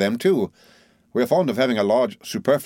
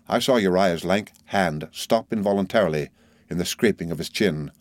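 usual speaking voice

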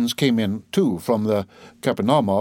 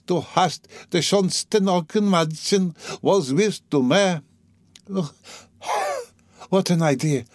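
high, rambling voice of a woman in delirium; delirious dying mother; 5 semitones higher than usual. Acted. Delirious mother recalls songs for her children, high halting ramble.

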